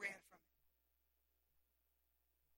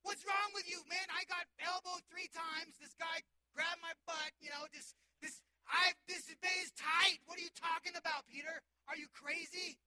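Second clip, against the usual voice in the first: loud, higher, fed-up Peter; annoyed Peter; 5 semitones higher, 8 dB louder than usual. Acted. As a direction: complain like a jostled, grumpy disciple. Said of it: "I get to see Peter like".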